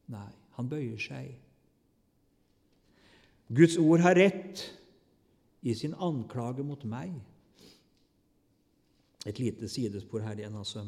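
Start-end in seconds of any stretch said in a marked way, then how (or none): 3.5-7.1 loud, slow declaration; solemn emphatic confession. Acted. Godly man submitting to God, slow emphatic confession. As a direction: proclaim it slowly and firmly.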